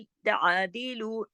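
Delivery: slow तादिलू with a settled throaty ain; firm ain. Shown as a demonstration. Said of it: "ऐन को जमाओ के साथ". (तादिलू में ऐन गले से जमाकर)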